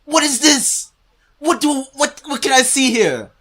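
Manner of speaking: high, loud disbelief; incredulous shouting. Real, not acted. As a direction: shout in exasperated bafflement. Incredulous at a blank screen.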